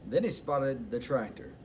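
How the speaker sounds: plain reading voice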